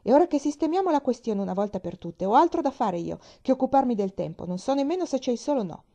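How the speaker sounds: put-on a grumpy man telling off a chatty girl, brusque. A narrator's reading. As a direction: snap it at the girl, annoyed. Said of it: "mantenendo il tono brusco".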